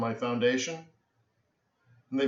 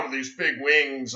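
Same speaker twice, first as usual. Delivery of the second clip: ordinary delivery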